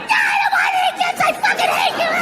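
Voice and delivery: put-on high, screaming tantrum of a little kid; furious toddler yell. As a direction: scream it like a toddler who hates his dad. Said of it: "And I'm like".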